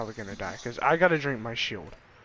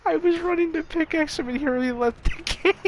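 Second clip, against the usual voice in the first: laughing; 13 semitones higher, faster than usual. Real, not acted. Enemy left before pickaxe.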